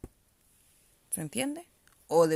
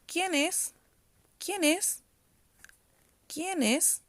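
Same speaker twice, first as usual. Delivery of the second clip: ¿Quién es? with the voice rising high at the end; rising question tune; 8 semitones higher, much slower than usual. Shown as a demonstration. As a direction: lift "es" high at the end, like asking at the door. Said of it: "al final de la pregunta yo como que alzo la voz". (entonación de pregunta, subiendo la voz al final de "¿Quién es?")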